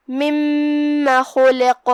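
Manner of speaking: مما with meem held two counts, very slow; doubled meem. Shown as a demonstration. Teacher demonstrating shadda on meem, slow recitation. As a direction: hold the meem in مما without a long alif. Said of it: "ہم دو حرف کے برابر میم کو پڑھیں گے".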